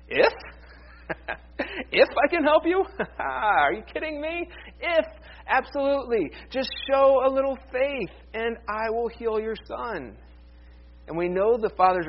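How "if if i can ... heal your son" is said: amused Jesus laughing off the doubt, pitched up; playful, incredulous Jesus, then steady reassurance; laughing disbelief. Retold or imitated. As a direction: brush off the doubt with a chuckle, almost teasing. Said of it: "I paraphrase Jesus' response".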